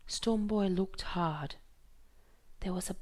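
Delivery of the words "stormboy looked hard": plain reading voice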